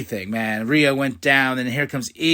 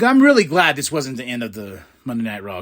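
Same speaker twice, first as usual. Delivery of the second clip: ordinary talk, nothing marked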